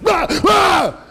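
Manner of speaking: acted-out yelps of fright; high-pitched comic scream; startled scream. Disciples startled as Jesus suddenly appears.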